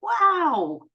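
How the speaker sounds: high, breathless amazement; stunned wow. Retold or imitated. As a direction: mind blown, squeal it out high. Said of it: "I was just like".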